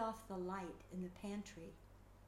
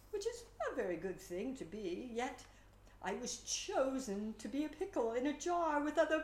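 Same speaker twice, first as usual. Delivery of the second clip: pickle character; 4 semitones higher, 4 dB louder than usual. Acted. Slice the pickle speaks.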